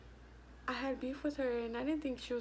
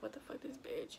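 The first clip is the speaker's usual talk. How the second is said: softer, muttered to herself; a private thought under the breath; inner thought; 2 semitones lower, 8 dB quieter than usual. Retold or imitated. Thought said in her head.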